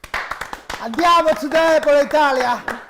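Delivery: slow, hammed-up Italian accent; mock Italian. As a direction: playfully put on an Italian air. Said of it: "so you're speaking Italian today".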